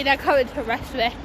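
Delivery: ordinary talk, nothing marked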